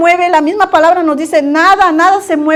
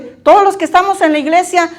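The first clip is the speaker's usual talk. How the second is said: ordinary talk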